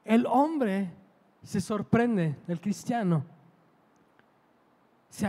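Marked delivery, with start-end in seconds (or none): none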